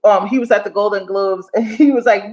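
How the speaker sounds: ordinary talk, nothing marked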